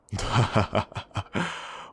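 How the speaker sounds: short gloating villain laugh; rising, amused villain chuckle. Acted. Villain gloats over trapped debtor.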